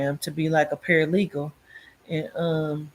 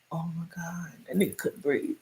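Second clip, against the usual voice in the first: hushed shock; 9 dB quieter than usual. Retold or imitated. Retelling choking him.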